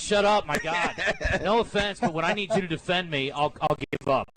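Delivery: ordinary talk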